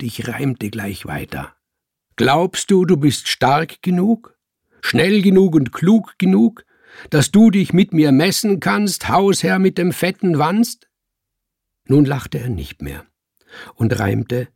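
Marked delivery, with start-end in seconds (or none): 2.1-10.9 loud, high rhyming taunt; taunting challenge. A narrator's reading. Cat challenging the fat landlord in rhyme, taunting. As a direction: throw down a challenge at full voice.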